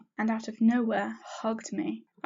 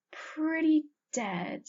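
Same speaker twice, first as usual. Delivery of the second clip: slow, higher smiling reply; 7 semitones higher, much slower than usual. A narrator's reading. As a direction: draw it out with a smile. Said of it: "she smiled".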